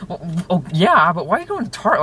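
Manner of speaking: high, spluttering mock protest; hammed-up flustered objection; imitating freak-out. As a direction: imitate a friend who can't believe it. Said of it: "he's freaking out".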